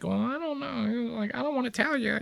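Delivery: high, soft, shy anime voice; bashful reluctance. Acted. Mocking coy anime characters too shy to tell, bashful. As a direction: squirm through it, tiny and high-pitched. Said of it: "touching their fingers together 'cause they're like".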